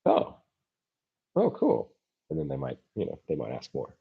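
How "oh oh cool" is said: "oh, cool" pitched way up, bright and interested; imitating an intrigued listener; mock interested surprise. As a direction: play someone pleasantly surprised. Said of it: "it'll be like".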